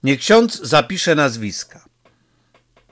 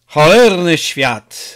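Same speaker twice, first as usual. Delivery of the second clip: slow, louder outburst; exclaiming; 2 semitones higher, 6 dB louder, slower than usual. A narrator's reading. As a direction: burst out, dragging the words. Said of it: "wykrzyknął". (Peppone wykrzykuje do starych kompanów: głośny okrzyk)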